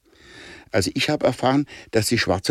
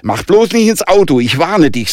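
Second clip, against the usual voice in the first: loud, high-pitched threat; stern warning; 7 semitones higher, 6 dB louder than usual. A narrator's reading. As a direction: warn him off sharply, starting loud and high. Girl warns queasy boy sharply.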